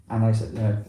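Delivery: usual speaking voice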